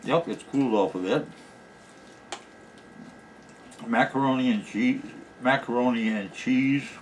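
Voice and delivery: talking with cheeks stuffed full; mouth full. Real, not acted. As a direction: speak round a mouthful of food. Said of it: "shouldn't talk with my mouth full".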